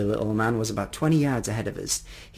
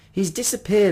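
high, louder cry of alarm; 9 semitones higher, 5 dB louder than usual. A narrator's reading. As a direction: call it out as the child in the story. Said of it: "I cried".

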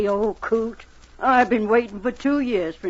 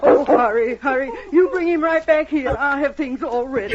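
urgent; 3 semitones higher, 5 dB louder than usual. Acted. Annie learns Bill is hurt.

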